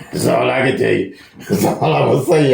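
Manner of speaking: amused, laughing as the words come out; chuckling. Real, not acted. Teacher boasting to viewers how easy it is, chuckling. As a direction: talk through a chuckle.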